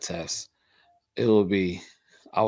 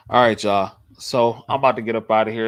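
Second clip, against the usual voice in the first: ordinary talk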